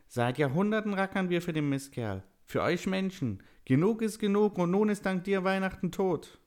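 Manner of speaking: very high, angry elf voice; resentful elf. A narrator's reading. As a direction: rant as a fed-up elf, high-pitched. Villain elf in a story.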